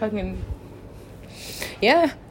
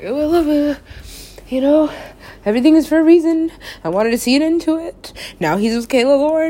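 louder, higher fake cheer; mock cheery gushing; 6 semitones higher, 7 dB louder than usual. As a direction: gush with forced brightness. Mock cheerful self-talk.

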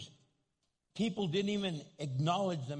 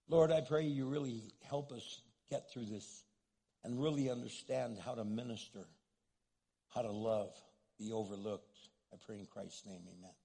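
quiet prayer; 8 semitones lower, 5 dB quieter than usual. Real, not acted. Pastor praying for the congregation, quiet and low. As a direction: lower and softer, a prayer.

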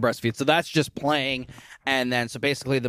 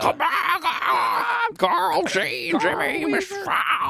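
hag mother calling out; much slower than usual. Retold or imitated. Imitating the monster mother calling after her children with a high crying shout. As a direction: wail for the lost kids like a crazed old hag.

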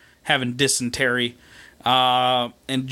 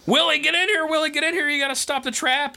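urgent shouting; 12 semitones higher than usual. Retold or imitated. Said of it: "they're like".

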